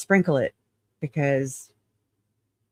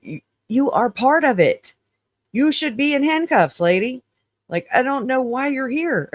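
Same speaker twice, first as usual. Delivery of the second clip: higher, pitched-up mock outrage at her; indignant retort; 8 semitones higher than usual. Retelling what she'd say to Melanie Gibb, in high indignant outrage. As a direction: tell her off like she belongs in cuffs.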